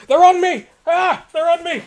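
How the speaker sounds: shrieked very high and loud; panicked screaming. Acted. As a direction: scream in panic as if bugs crawl on you.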